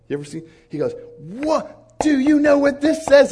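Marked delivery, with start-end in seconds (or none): 1.2-3.3 high, very loud challenge; hammed-up outburst, pounding out each word; imitated booming sheikh. Sheikh reading the Bible at the table, loudly astonished. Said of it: "That's how he talks".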